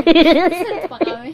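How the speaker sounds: loud laugh; laughing while telling; real laughter. Real, not acted.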